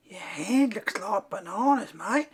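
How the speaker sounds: high-pitched mocking Australian bloke; heckling Australian mate. Australian mate mocks the painter's hand, teasing. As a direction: needle him like an Australian mate.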